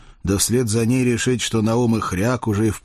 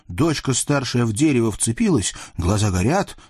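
ordinary delivery, nothing marked

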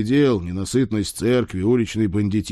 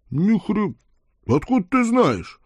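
high-pitched startled yelp; startled outburst; 11 semitones higher than usual. A narrator's reading. Lord learns his servant knows about horse poetry, startled high yelp. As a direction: shoot far up in pitch, caught out.

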